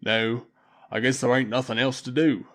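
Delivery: rough Londoner. A narrator's reading. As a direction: grumble it roughly. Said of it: "said Throck".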